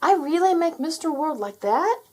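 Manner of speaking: put-on a high, amazed child's question; a boy who can hardly believe it; unbelieving. A narrator's reading. Terry asks Puff about the world, high and unbelieving.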